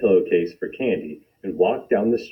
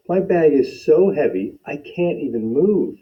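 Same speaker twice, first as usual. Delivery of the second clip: put-on boy's voice; 7 semitones higher than usual. Lance pleads with policeman.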